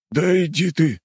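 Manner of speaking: high, blurted out in shock; stunned blurt. Acted. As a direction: blurt it, floored. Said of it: "ошалело выпалил ушастый".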